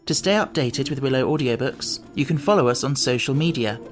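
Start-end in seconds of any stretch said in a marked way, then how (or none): none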